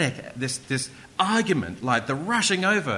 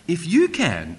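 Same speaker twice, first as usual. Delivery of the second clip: loud, raised "if you can", with "you" punched; exclamation mark; 4 semitones higher, 7 dB louder, slower than usual. Acted. Jesus turns the father's words back, emphatic exclamation. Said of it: "exclamation mark".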